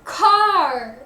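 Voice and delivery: CAR loud and high, then falling; strongly emphasized CAR; main stress on CAR. Shown as a demonstration. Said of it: "has the main stress".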